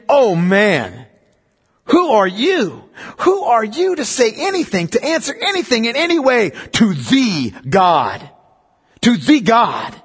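rebuking the proud questioner with emphasis; emphatic rebuke. Retold or imitated. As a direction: press each who hard. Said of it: "he does it in an emphatic nature".

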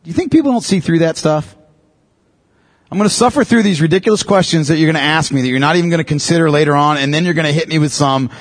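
normal talking voice

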